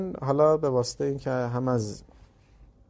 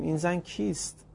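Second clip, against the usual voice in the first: soft, slow, a touch higher; disbelieving aside; 5 semitones higher, 5 dB quieter, slower than usual. A narrator's reading. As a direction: mutter it in quiet disbelief.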